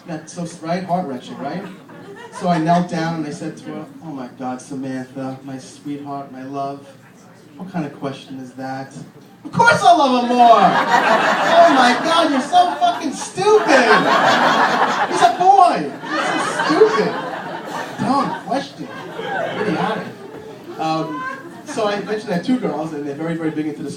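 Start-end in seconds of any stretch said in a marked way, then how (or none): 4.1-9.0 put-on soft, low sweet-talking to a little girl; tender. Dad kneeling to comfort his daughter, soft and tender. 9.4-20.1 sudden high, loud mock yelling; mock outburst. Dad turning on his daughter, yelling high and loud.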